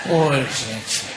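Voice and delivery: low, softened groan of exasperation; weary dismay. Retold or imitated. Blind passenger despairs at helpful strangers, low and weary. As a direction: sigh it out low, resigned.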